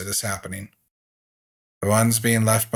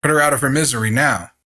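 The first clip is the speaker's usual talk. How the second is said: loud, fast angry command; doctor demanding an end to the suffering; shouting order; 5 semitones higher, 7 dB louder, faster than usual. A narrator's reading. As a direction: bark the order impatiently. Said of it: "he shouts at Kime".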